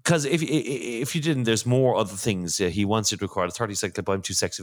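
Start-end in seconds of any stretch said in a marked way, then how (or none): none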